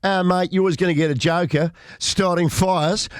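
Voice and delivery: put-on Australian accent, casual; Australian police officer. Host mimics Australian police on bushfires, in an Australian accent. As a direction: shrug it off like an Aussie cop. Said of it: "they talk to the police and they go".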